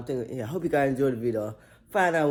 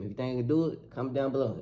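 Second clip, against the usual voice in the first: usual speaking voice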